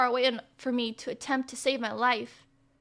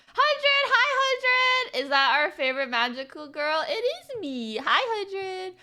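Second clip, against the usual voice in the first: excited greeting; 7 semitones higher, slower than usual. Real, not acted. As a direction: squeal a greeting.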